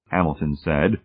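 usual speaking voice

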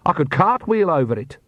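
a touch of boastful confidence; insistent. A narrator's reading. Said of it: "Perdita insisted".

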